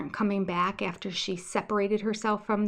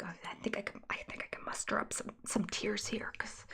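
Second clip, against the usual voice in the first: whispered mock inner voice, quick; hushed, sarcastic imitation of a scheming thought; 14 dB quieter, faster than usual. Whispering Robin's imagined thoughts while mocking her. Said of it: "she's like".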